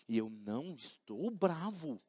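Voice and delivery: low, slow, held-back denial; denying it through clenched patience; quietly insisting he is not angry; mock restrained denial. Acted argument, denying anger.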